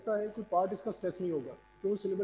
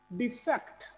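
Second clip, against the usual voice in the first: Defect with a higher, stressed fact; defect as di-FECT, rising; 4 semitones higher, slower than usual. Shown as a demonstration. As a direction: lift the second syllable of defect. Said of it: "stress किस पे हुआ".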